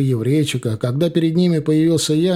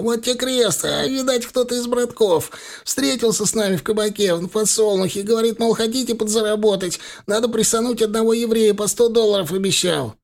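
pained confession; 9 semitones higher than usual. A narrator's reading. Thug confesses under pain.

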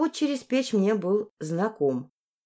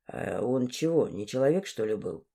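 low, soft male character voice; young man's voice; 8 semitones lower, 5 dB quieter than usual. Acted. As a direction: drop well down for a doubting lad. Dmitry, young man, butts in.

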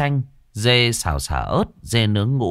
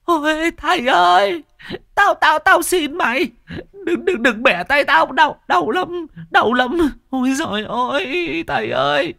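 shrieking plea. Acted. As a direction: howl for mercy like a tortured spirit. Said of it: "mở choàng mắt mà rú lên".

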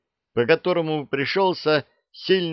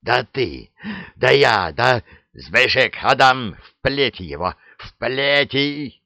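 sputtering rage, louder on the names; furious shouting; 3 semitones higher, 4 dB louder than usual. A narrator's reading. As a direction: choke on rage, then order the lashing. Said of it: "ярости".

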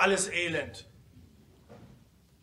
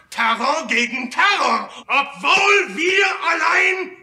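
fervent shout; 10 semitones higher, 9 dB louder than usual. Acted. Speaker demanding terror from delegates, fervent shout. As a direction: build to a passionate climax.

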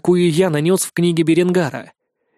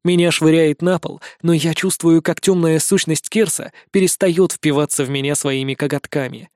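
plain reading voice

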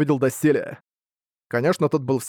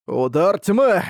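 shouted attack name, pitched up; battle cry; 4 semitones higher, 3 dB louder, much slower than usual. Acted. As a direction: call out the strike.

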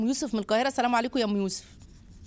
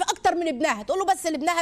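normal talking voice

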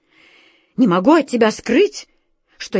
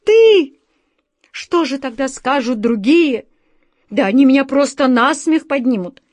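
higher, louder, bitter outcry; bitter exclamation; 5 semitones higher, 5 dB louder than usual. A narrator's reading. The director bitterly reproaching his friend, loud and high. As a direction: cry out hurt and bitter. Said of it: "с горечью воскликнул".

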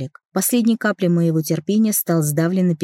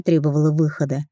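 usual speaking voice

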